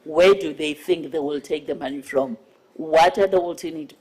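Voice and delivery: ordinary talk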